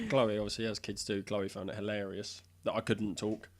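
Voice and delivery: usual speaking voice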